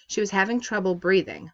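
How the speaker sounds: ordinary delivery, nothing marked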